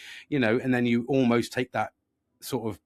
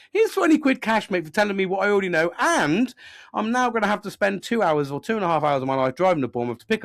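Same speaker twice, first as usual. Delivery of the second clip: high, louder mock-grateful rant; sarcastic outrage; 7 semitones higher, 3 dB louder than usual. Customer paying mechanic for what he knows, sarcastically outraged. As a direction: itemise the injustice with rising exasperation.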